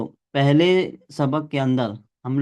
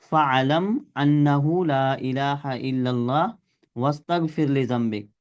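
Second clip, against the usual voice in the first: Quran recitation. Acted. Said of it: "Quran की एक आयत है".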